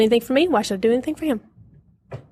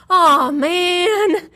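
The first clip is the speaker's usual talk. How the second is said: mock groan; 8 semitones higher, 4 dB louder than usual. Husband groaning at his schedule.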